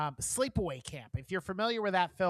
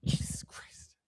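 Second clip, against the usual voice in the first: breathless whisper after a jump scare; startled whisper; slower than usual. Real, not acted. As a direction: gasp it out, spooked. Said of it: "scared the fuck out of me".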